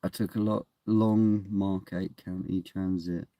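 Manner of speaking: ordinary talk, nothing marked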